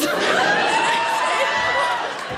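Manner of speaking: high, shrieking laugh breaking out; a real high cackle of delight; burst of real laughter. Real, not acted. Teller cracks up at grandma's secret.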